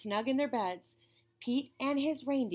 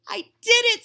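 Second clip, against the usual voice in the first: much higher, much louder cry of victory; triumphant shout; 13 semitones higher, 13 dB louder than usual. Acted. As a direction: shout it like you just won. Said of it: "said Pete".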